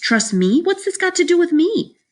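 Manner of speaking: put-on high, incredulous kid; indignant protest. A narrator's reading. Boy answers his scolding mother, high indignant protest. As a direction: bristle at unfair blame, pitch shooting up.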